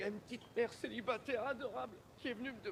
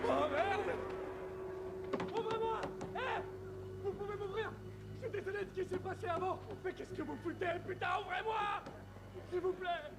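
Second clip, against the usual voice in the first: high, urgent shouted begging; panicked pleading; 9 semitones higher than usual. Acted. Locked-out man begs to be let in, pleading frantically. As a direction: yell through the door in panic.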